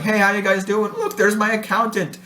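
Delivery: imitating a guide hailing passers-by, high-pitched; high-pitched, jokey mock hail; calling out. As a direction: playfully call out to people below. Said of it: "He would call out to different people".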